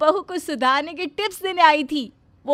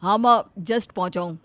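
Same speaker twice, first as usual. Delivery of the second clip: lower, cheerful son coming home; a lighter male son's voice, lower; 7 semitones lower than usual. Acted. Son arriving home, sniffing the cooking, pleased.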